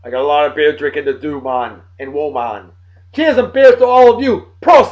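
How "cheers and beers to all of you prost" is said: cheers, high and loud, glass up; hearty toast. Real, not acted. Toasting the viewers, a loud cheery cheers. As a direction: raise your voice into a big cheery toast.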